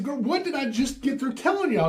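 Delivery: high, scolding retold fury; angry scolding. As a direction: scold the girls, pitch jumping up. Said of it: "I was furious".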